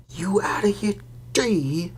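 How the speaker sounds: high breathless yelp. Acted. Stan yelping at Harry for saying the name, high yelp. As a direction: squeak it out in fright. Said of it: "yelped Stan".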